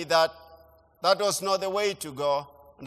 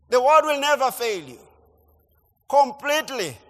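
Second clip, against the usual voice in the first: preaching build; 5 semitones higher, 3 dB louder than usual. Acted. Preaching climax.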